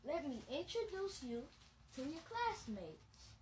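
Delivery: put-on storybook voice for kids; bedtime-story narration; reading to children. A narrator's reading. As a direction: read it like a picture book to little ones. Reads picture book aloud.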